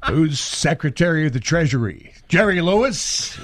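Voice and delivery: amused, incredulous Nicholson impression; mock disbelief with a Nicholson sneer; Jack Nicholson as Doc Brown. Doc Brown as Nicholson scoffs at Marty's future. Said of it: "Jack Nicholson is Doc Brown".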